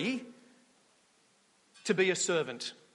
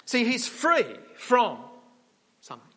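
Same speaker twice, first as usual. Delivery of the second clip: preacher dwelling on free; stress on free; 4 semitones higher, 4 dB louder than usual. Real, not acted. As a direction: lean hard on free. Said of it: "slow emphatic point".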